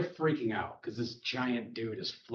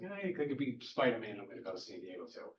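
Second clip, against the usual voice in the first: retold sly aside; 6 dB quieter than usual. Peter Parker slips away from his ex, quiet aside. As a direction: voice sinking into a quick mumble.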